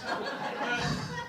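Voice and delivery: high unvoiced giggle; real airy giggle after the punchline; breathy giggle. Real, not acted. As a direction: let out a quick airy laugh. Laughs after joke.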